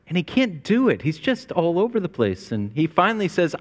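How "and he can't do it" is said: normal talking voice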